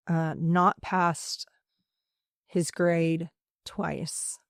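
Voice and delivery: slow, breaking whisper holding back tears; real emotion dropping to a slow whisper; choked up. Real, not acted. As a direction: barely get it out, fighting the lump in the throat. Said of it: "this is one that's gonna get me".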